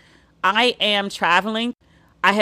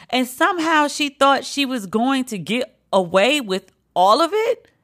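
normal talking voice

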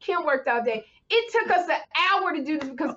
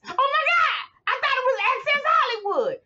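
much higher, squealing with amusement; excited shriek; 12 semitones higher than usual. Retold or imitated. Mimicking Kim cracking up at the ad, high squeal. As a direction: squeal it like you just got the joke. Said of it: "she was like".

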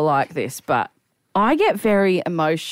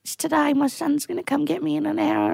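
lightly voiced old man, high-pitched; elderly man; 10 semitones higher than usual. Elderly man explaining his party plans, high gentle voice.

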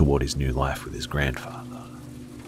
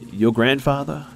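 put-on surprised girl voice; 11 semitones higher than usual. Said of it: "she said".

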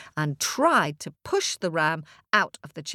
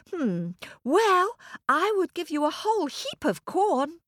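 lightly voiced small boy; boy bargaining; 11 semitones higher than usual. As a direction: offer it like a hopeful child.

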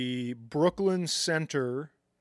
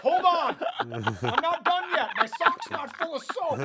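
laughing; 11 semitones higher than usual. Real, not acted. Laughing joke interjection.

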